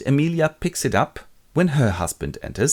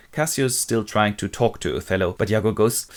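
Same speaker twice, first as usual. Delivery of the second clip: ordinary talk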